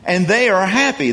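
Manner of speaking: ordinary talk, nothing marked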